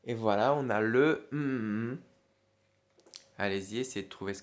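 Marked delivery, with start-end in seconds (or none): 1.3-2.0 loud drawn-out hum; humming for a blank. Shown as a demonstration. Teacher hiding the word to be found, loud humming. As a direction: hum in place of the hidden word. Hums in place of hidden word.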